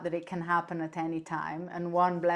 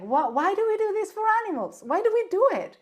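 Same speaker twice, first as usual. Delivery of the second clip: much higher, fast disbelieving outcry; shocked; 13 semitones higher, 5 dB louder than usual. Retold or imitated. Owner stunned after forcing a pill on her cat, shocked. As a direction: retell the shock of realisation. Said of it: "I was completely shocked".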